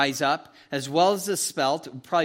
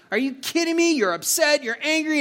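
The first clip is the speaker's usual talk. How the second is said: incredulous outburst; 10 semitones higher, 4 dB louder than usual. Retold or imitated. Voicing own outrage at hail.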